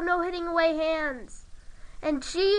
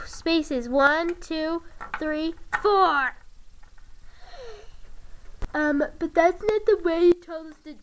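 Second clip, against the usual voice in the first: usual speaking voice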